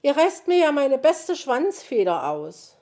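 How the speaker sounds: high, loud lament; wailing complaint. A narrator's reading. Magpie's feathers are being pulled, loudly wailing. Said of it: "jammerte die Elster".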